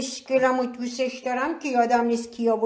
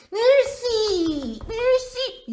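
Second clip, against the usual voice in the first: high, slow, giggly thank-you; delighted laughing thanks; 11 semitones higher, much slower than usual. Real, not acted. Thanking the kids for naming the bay leaf, laughing high. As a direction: squeal your thanks through a laugh.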